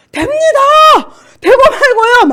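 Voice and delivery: very high, loud, drawn-out cry of assent; shouting yes. Retold or imitated. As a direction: shout it up to the Lord. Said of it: "막 주님한테 막 소리쳤어요". (주님께 크게 외치는 응답)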